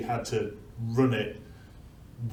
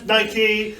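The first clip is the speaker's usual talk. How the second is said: high, louder mock cry; retold shout; 9 semitones higher, 5 dB louder than usual. Runner shouting victory before dropping dead, retold shout. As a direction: imitate a triumphant victory call. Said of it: "So he shouts".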